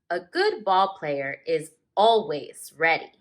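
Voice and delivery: slow, expressive read-aloud with long page-turn pauses; story narration. A narrator's reading. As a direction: read it like a teacher sharing a picture book with the class. Teacher reads picture book aloud.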